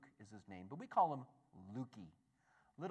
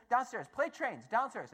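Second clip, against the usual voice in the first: put-on higher and louder, insistent toddler demand; a little boy who won't take no; eager toddler; 9 semitones higher, 8 dB louder than usual. Toddler tells his dad at night he wants trains, insistent. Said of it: "And he's like".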